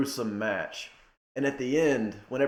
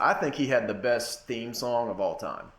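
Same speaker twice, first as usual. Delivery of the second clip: ordinary talk, nothing marked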